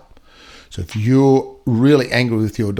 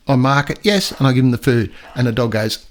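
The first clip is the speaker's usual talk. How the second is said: ordinary delivery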